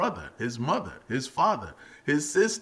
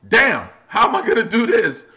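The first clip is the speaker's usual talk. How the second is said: mock panic; 11 semitones higher, 11 dB louder than usual. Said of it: "I was like".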